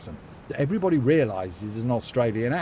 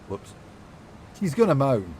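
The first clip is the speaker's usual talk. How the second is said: ordinary talk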